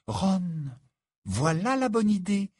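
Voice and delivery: high-pitched, amazed approval; astonished exclamation. A narrator's reading. Hermione stunned by Ron's good idea, astonished. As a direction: exclaim in wonder, voice way up. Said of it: "s'exclama Hermione qui paraissait stupéfaite".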